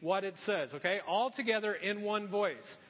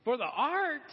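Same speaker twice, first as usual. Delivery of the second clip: higher, questioning repeat in disbelief; incredulous echo; 6 semitones higher, 5 dB louder than usual. Retold or imitated. Pastor challenging his friend's excuse, incredulous. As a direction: throw the excuse back as a rising question.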